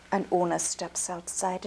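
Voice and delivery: ordinary delivery, nothing marked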